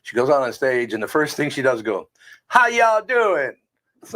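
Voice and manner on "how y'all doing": slow, high put-on Southern drawl; mock Southern accent, stretched out; fake Southern greeting. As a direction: ham up a folksy Southern hello. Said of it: "you change your accent?".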